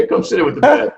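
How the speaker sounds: big bright holler, come join us; calling out. Retold or imitated. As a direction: shout the invite over to the neighbor. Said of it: "He'd say".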